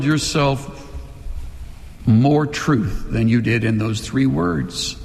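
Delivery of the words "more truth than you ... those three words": normal talking voice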